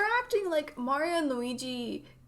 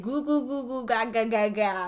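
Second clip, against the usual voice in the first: put-on baby talk. Quoting baby Toad's babble.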